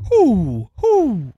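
loud rising hoots; owl hoot. Pretend. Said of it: "make forest noises".

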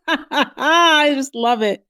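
high, slow words through laughter; laughing delight. Real, not acted. Host cracking up over Riot's ridiculous line, laughing delight.

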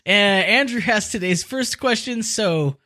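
ordinary delivery

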